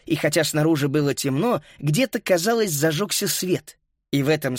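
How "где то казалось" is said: ordinary delivery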